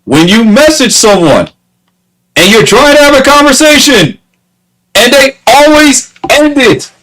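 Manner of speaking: high-pitched, exasperated complaint; real frustration, voice pitched high; irritated rant. Real, not acted. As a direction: let the irritation push your voice way up. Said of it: "it really does irritate me".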